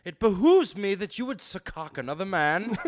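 hammed-up Shakespeare voice; mock Shakespearean. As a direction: ham it up like a stage actor. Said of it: "Those are some stress syllables".

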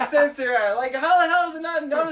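high-pitched, loud comic outrage; exasperated rant. Acted. Man in the dark stall yelling at the motion sensor, exasperated. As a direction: rail at it in a high, strained yell.

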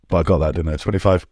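usual speaking voice

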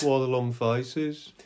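slow Brummie echo; Brummie shrunken head. Acted. Echoing co-host's shrunken head, slow Brummie accent. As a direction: stretch the vowels in mock Brummie. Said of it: "imagine a Brummie shrunken head".

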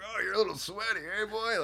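high-pitched needling imitation of a castmate; imitated ball-busting jibe; mock teasing. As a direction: rib him in a put-on high voice. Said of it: "just busting my balls".

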